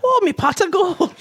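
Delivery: high, squeaky joke Irish accent. Shown as a demonstration. Asked for an Irish impression, hammed-up Irish accent. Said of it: "a little bit of an Irish impression".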